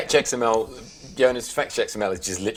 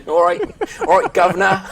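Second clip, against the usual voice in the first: hammed-up Cockney, higher and louder, with a laugh; mock Cockney; 8 semitones higher, 7 dB louder than usual. Podcast guest joking about a Friends episode, mock Cockney.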